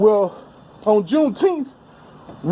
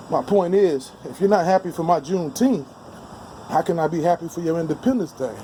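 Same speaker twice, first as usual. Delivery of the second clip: ordinary talk, nothing marked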